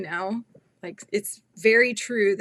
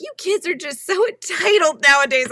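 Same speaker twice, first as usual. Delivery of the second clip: put-on higher, louder disapproving old-school mom; sounding like my mom; 9 semitones higher, 9 dB louder than usual. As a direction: lecture like your own mother would. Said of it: "I just sounded like, you know, something my mom would say".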